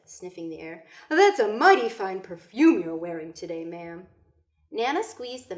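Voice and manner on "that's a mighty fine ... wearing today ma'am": put-on charming old man, louder; lightly voiced gallant old man; blind man. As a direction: flatter the lady gently. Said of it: "the man said".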